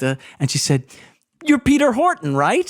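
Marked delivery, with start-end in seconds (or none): 1.4-2.7 high-pitched, eager recognition; excited fan. Retold or imitated. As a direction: imitate a starstruck woman, voice pitched way up. Retold fan mistaking him for someone.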